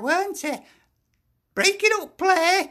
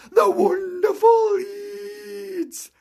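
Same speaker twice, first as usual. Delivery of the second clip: sarcastic praise; 3 semitones higher, much slower than usual. Real, not acted. Fan mocking rival club Leeds.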